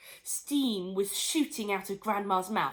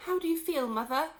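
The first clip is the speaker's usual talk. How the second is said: anxious; 7 semitones higher than usual. Acted. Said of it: "asked Missus Cranky anxiously".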